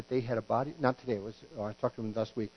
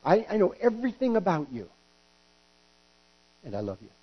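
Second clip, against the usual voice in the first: God's tender voice; 4 semitones higher than usual. Retold or imitated. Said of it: "God says".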